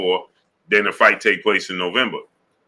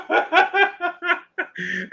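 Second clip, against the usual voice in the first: high burst of laughter. Real, not acted. Streamer cracking up at a viewer's story, high squealing laugh.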